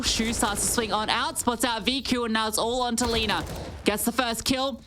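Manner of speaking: building live commentary; excited play-by-play. Acted. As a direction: call the action with rising excitement.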